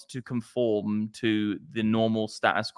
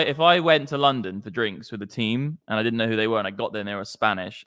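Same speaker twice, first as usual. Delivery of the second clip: ordinary delivery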